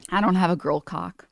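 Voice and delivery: normal talking voice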